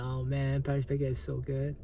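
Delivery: normal talking voice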